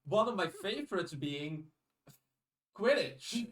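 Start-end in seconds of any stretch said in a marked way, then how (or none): none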